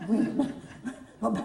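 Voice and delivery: ordinary delivery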